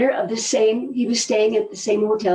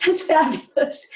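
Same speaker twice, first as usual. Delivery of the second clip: higher, laughing through it; laughing praise; 5 semitones higher than usual. Retold or imitated. Repeating praise to the presenter with a laugh. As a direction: let a laugh lift the praise.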